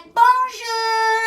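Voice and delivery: playing a giddy tourist; mock French greeting. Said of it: "And she's like".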